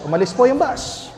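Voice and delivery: ordinary delivery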